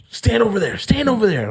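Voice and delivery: high, insistent command; ordering attendant. Retold or imitated. Tow guy ordering the teller away, high insistent command.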